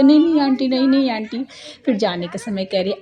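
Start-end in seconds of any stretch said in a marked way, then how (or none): 0.0-1.4 lightly voiced girls refusing, higher and louder; quick-repeated refusal, imitating the girls; imitated girls' protest. Girls turning down aunty's vlog offer, imitated protest.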